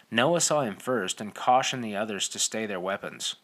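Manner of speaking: plain reading voice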